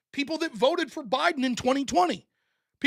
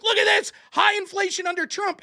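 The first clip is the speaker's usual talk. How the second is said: higher, mock shouted gotcha; mock a gleeful accusation, raised and higher; mock alarm; 7 semitones higher than usual. Pundit imitates critics crowing over inflation numbers, higher mock shout. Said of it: "they were like".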